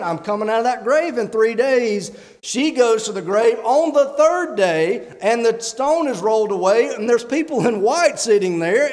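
preach. Real, not acted. Preacher to congregation, climbing in fervent preaching. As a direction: build the sermon to a ringing peak.

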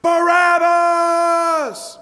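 high, slow, drawn-out shout of a mob; a mob crying out for release; crowd screaming. Retold or imitated. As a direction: bellow the name like a frenzied crowd. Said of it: "they screamed".

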